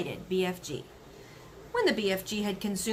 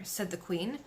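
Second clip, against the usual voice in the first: usual speaking voice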